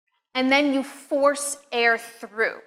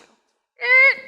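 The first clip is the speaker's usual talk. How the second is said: high, loud imitated buzzer; wrong-answer buzzer; 12 semitones higher, 7 dB louder than usual. Shown as a demonstration. Teacher rejects the second mistake, mimicking a buzzer. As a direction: buzz "er" like a game-show error. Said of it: "that's wrong too".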